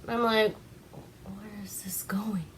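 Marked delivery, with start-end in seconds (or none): 1.2-2.4 hushed, whispered bewilderment; whispered aside. Retold or imitated. Teller voices own baffled aside.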